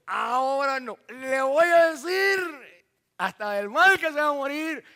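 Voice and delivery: loud, high angry wife shout; wife yelling back. Acted. Wife answers her husband, shouting angrily.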